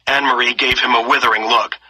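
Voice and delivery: ordinary delivery, nothing marked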